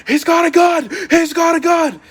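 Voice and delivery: high, loud panicked shout; frantic high shout of alarm; retold actor yelling. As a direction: yell it like an actor in a scene. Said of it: "like, yelling".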